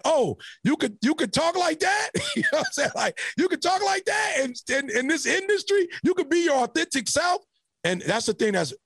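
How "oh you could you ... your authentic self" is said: amazed peers. Retold or imitated. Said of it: "people who looked at me and was like".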